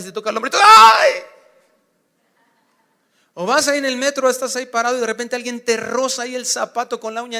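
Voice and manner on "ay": loud, high yelps of pain; cries of pain. Acted. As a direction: yelp like someone stepped on your foot. Friend touches sunburned shoulder.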